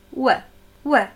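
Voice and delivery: "W" as a short English glide; English W sound. Shown as a demonstration. Teacher isolating the sound at word start, brief English "W". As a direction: say "W" briefly with rounded lips. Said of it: "Ein vertrauter Klang für diejenigen".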